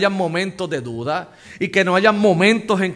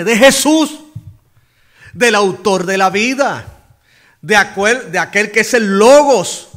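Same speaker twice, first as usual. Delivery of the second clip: louder, ringing preacher build; fervent pulpit delivery; rising preaching; 4 semitones higher, 6 dB louder than usual. Acted. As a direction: lift the congregation with Christ's name.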